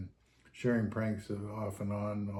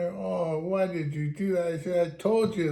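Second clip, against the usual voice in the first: higher, louder mock exasperation; exasperated alarm; 8 semitones higher, 5 dB louder than usual. Captain scolds the injured driver, higher exasperated alarm. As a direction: scold him in alarmed disbelief. Captain's retold upset reply.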